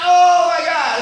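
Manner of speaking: high, loud alarmed exclamation; mock horror. Uncle reacts to niece's screech, horrified. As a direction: recoil in comic dismay.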